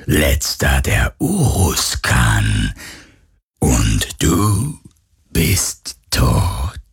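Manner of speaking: deep, slow, menacing phantom. A narrator's reading. Phantom pronounces Galen dead, deep slow menace. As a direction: draw the words out low like a ghostly executioner.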